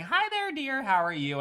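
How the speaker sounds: put-on high, sweet little-old-grandma voice. Grandma greeting her grandchild, sweet and high. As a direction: coo it like a doting granny. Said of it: "grandma walks behind you".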